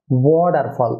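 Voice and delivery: "ওয়াটারফল" as WO-dar-fol, D for T; correct pronunciation. Shown as a demonstration. Teacher models the correction, "ওয়াটারফল" as WO-dar-fol. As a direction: round the "wo" and soften T in "ওয়াটারফল". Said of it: "ওয়া না হয়ে হবে".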